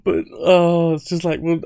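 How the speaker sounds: excited outburst. Real, not acted. Amused outburst at the irony.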